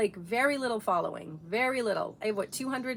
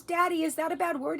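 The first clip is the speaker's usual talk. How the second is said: put-on little girl; 8 semitones higher, 4 dB louder than usual. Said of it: "She goes".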